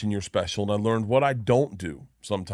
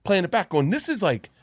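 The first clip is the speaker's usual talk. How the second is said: excited praise; 7 semitones higher, 5 dB louder than usual. Retold or imitated. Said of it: "And I was like".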